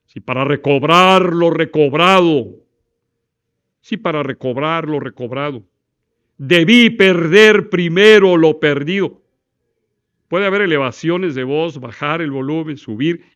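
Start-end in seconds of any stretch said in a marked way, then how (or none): 0.1-9.2 loud slow swells, a drop in the middle, then louder; modulated volume. Shown as a demonstration. Teacher demos modulation on the poem. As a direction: play with rising and falling volume. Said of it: "puedo estar jugando con la elevación de la voz".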